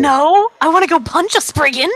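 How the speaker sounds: put-on high, loud little-girl outburst; eager protest. Girl refusing to stay behind, eager high protest. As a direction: protest loudly in a high, excitable voice.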